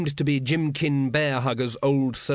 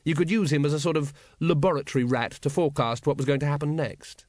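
usual speaking voice

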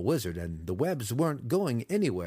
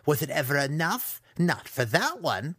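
high, slow, emphatic bitterness; aggrieved young villain's outburst; angry yelled rant; 6 semitones higher than usual. A narrator's reading. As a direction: spit out each grievance. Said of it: "Massage yelled".

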